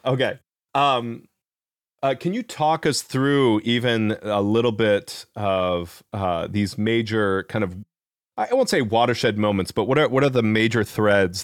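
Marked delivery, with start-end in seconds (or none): none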